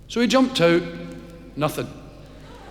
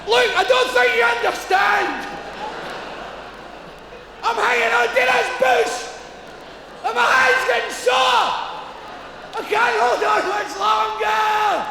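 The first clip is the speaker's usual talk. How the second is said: desperate shout. Acted. Man begging God as his grip fails, desperate shout. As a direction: cry out, hands giving way.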